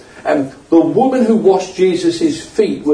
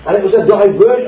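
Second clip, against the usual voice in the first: higher pitched, pressing the point hard; raised preaching voice; 7 semitones higher than usual. Real, not acted. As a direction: preach it up, nearly shouting. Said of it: "Am I shouting?".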